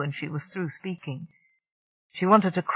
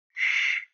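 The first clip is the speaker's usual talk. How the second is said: whispered shush. A narrator's reading. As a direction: hush them with a quick shh. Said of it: "Seg whispered".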